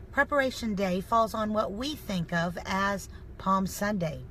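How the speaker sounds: usual speaking voice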